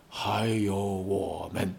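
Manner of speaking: 还有我们 said slowly and softly, almost a hush; 还有我们 eased out gently and quietly to end the speech; tender close. Acted.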